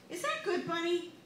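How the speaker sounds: high sing-song coaxing; bright high mother-to-toddler voice. Acted.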